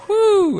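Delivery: high, loud exhaled whew; delighted whew. Real, not acted. Pastor reacting to the quote with a loud whew. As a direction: let out a big impressed whew.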